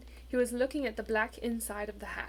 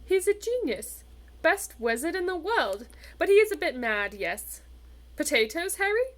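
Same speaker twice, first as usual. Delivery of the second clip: higher, louder breezy prefect; airy; 6 semitones higher, 6 dB louder than usual. A narrator's reading. Percy airily chatting to Harry and offering potatoes, higher and louder. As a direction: chat on breezily, offering food. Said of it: "said Percy airily".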